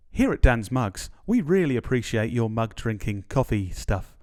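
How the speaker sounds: ordinary talk, nothing marked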